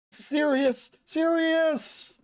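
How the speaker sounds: high, drawn-out cry of a name; loud calling out. Acted. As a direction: call someone back from far away. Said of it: "shout over and over with that stupidly loud voice".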